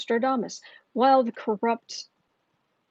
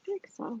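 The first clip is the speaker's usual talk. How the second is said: dramatic sigh; 8 semitones higher, 11 dB quieter than usual. Shown as a demonstration.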